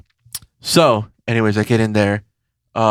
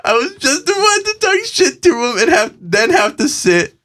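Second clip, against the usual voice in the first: rising disbelief; 11 semitones higher than usual. Real, not acted. Building outrage.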